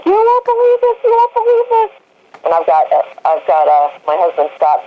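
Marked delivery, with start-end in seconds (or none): 0.0-1.9 much higher, breathless squeal of news; excited mom. Retold or imitated. Mom running to the trailer with a ribbon, squealing excitedly. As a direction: gush it out like big news. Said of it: "And she's like".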